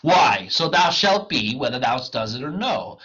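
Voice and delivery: loud, declaimed menace; theatrical threat. Acted. Wagner threatens the clown into service, loudly declaimed. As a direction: bear down hard on the opening words.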